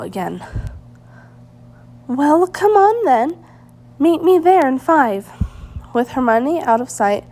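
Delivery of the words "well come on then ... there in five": higher and louder, hurrying them along; impatient urging. A narrator's reading. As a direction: urge them to follow right now. Hermione urging, ready to race.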